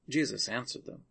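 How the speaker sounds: ordinary delivery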